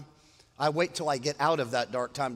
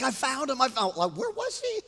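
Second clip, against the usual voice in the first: higher-pitched mock panic; imitating frantic wife; 9 semitones higher than usual. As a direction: play a wife freaking out. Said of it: "she was, like, freaking out".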